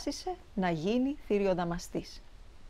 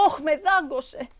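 high, drawn-out yelp of complaint; pained cry; 7 semitones higher than usual. A narrator's reading. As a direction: cry out slowly, as if just bitten. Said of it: "Ο μπαμπάς φώναζε". (ψηλή, συρτή κραυγή πόνου)

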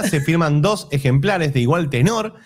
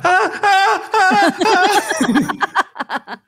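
high mock wail; 3 dB louder, much slower than usual. Mock Mother Earth cry.

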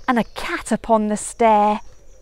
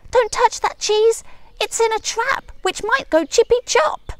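high, loud spider cry of alarm; spider shouting; 12 semitones higher, 4 dB louder than usual. Acted. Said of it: "a spider shouted".

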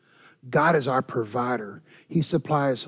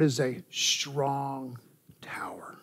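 hushed, breathy reverent whisper; 2 semitones lower, 8 dB quieter, slower than usual. Acted. Preacher drops to whisper.

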